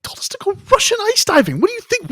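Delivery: squeaky, incredulous outburst; high-pitched disbelief. Real, not acted. Explaining to co-host why he spared Volkov, high-pitched disbelief. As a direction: shoot way up high in comic protest. Mock-indignant answer about scary Volkov.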